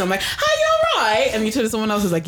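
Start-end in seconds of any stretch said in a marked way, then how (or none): 0.2-1.3 high-pitched, laughing greeting; switched greeting voice. Retold or imitated. Guest showing how she greets one person, high laughing voice. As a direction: sing out a high, exaggerated hello. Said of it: "I just turn to one person".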